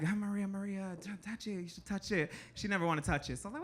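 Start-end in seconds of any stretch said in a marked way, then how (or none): 0.0-2.3 soft, coaxing murmur; lightly voiced teenage come-on, hushed.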